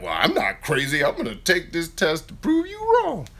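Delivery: high, indignant mock protest; huffy relative taking the dare; defensive family member. Family member insisting they're not crazy, mock indignant. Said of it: "And they're like".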